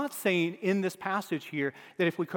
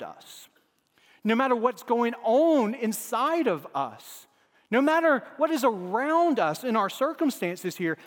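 louder, higher rising preacher's climax; preaching at full force; 4 semitones higher, 4 dB louder than usual. Acted. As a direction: build the congregation up to the big point.

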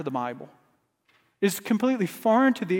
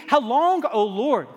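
rising cry; 3 semitones higher than usual. Acted. Preacher crying out to God for a congregation, climbing plea. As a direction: lift it up, a cry that will not give up. Preacher builds a repeated lament.

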